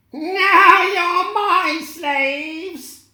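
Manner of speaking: high, loud, very slow gloating witch voice; cackling witch. Acted. The witch gloating over her captured prisoners, high and loud. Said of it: "she cackled".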